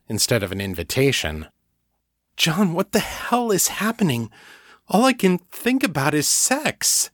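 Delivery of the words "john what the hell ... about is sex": put-on higher young woman's voice, alarmed; Kate, bewildered. A narrator's reading. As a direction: voice Kate, rattled and confused. Kate's voice in narration.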